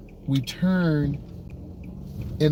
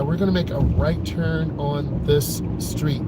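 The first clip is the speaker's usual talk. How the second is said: normal talking voice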